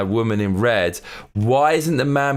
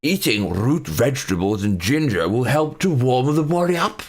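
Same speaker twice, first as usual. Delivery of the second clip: hammed-up posh British article reading; exaggerated posh British accent. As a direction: read it like a plummy British toff. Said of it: "I'm reading this in a posh British accent".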